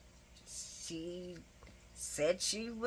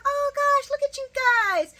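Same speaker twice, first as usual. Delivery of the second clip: much higher, louder gushing tease; gushing Brooklyn; 10 dB louder than usual. Retold or imitated. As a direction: coo at the couple in a high teasing voice. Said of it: "She's like".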